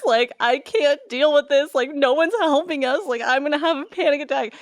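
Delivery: on the edge of tears, letting it all out; distraught wail. Retold or imitated. Retold tearful breakdown at gallery.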